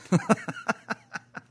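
high burst of laughter; laughing. Real, not acted. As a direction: crack up laughing. Laughing at own impression.

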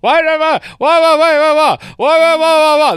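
very high, loud hammy shouting; mock a ham actor yelling every word; Hippias shout-talking. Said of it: "That's basically how he delivers his big speech".

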